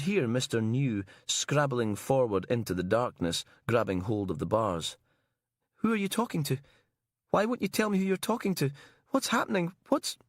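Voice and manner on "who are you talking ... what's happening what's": high, fast, rising panic; frantic questions in the dark; panicked. Acted. Panicking prisoner.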